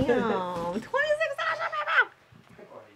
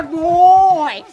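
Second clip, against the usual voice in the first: praising the dog; 8 semitones higher, 17 dB louder than usual. Real, not acted.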